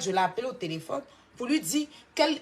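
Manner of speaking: normal talking voice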